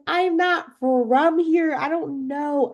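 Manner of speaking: high, pleading mock panic; panicked. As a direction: whine it out high and helpless. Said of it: "I'm like".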